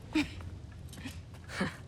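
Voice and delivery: soft laugh. Acted. Teasing laugh.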